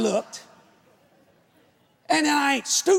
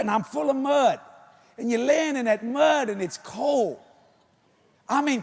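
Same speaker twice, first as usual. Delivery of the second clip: ordinary talk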